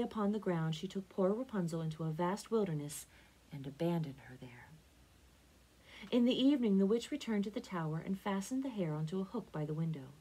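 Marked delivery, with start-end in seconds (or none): none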